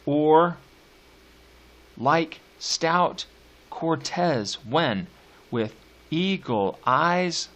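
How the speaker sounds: slow, measured poetry recital; drawn-out reading of a sonnet; poem reading. Acted. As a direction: lean on each stressed beat, slowly.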